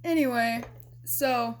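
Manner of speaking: normal talking voice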